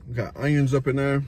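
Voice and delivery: talking with food in mouth; chewing while talking. Real, not acted. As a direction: mouth partly full. Seen 0: Bit sandwich at -7, chewing with lips pursed through early words.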